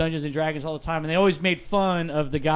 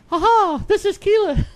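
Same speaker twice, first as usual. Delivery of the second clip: much higher, a bit louder, hammed-up; mock Keela voice; 13 semitones higher, 5 dB louder than usual. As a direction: squeak it out like a silly shapeshifter. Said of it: "right now he sounds like".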